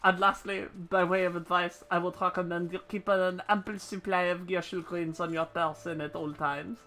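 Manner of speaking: put-on French-accented advice; bad French accent. Ishgardian instructor advising on greens supply, bad French accent. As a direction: keep up the clumsy French accent. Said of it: "I'm so bad at this fucking accent".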